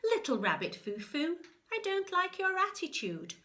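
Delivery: a bossy good fairy scolding; stern fairy. Acted. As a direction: scold the rabbit like a fussy fairy. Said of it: "the good fairy ... said".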